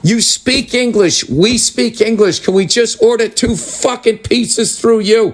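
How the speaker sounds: exasperated disbelief. Pretend. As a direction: talk down to him, slow and incredulous. Exasperated at pizza place clerk.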